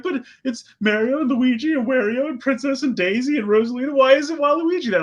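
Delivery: high, pleading whine; mock a whining fan; whiny fan voice. Mocking fans' complaint.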